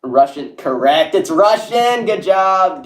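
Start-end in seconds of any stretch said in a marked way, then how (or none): 1.2-2.8 higher-pitched delighted announcement; pleased, lifted exclamation; excited congratulation. Real, not acted. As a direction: cheer the winning guess. Cheers correct guess in chat.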